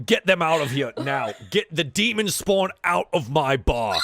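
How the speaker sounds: loud, forceful order to throw them out; angry barkeep. Acted. Barkeep ordering the demon spawn out of his bar, angry. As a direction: command it firmly and loudly. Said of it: "he looks to the side and just".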